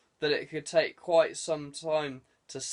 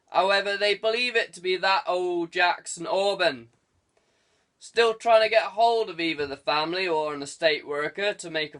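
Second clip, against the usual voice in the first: louder, higher gruff West Country sergeant; chuckling old copper on the phone; teasing old desk sergeant; 6 semitones higher, 6 dB louder than usual. A narrator's reading. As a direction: rib the lazy young inspector. Sergeant reporting the shooting.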